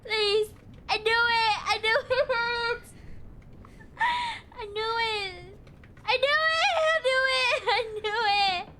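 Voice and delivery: real panic, voice cracking as the angels appear; panicked. Real, not acted.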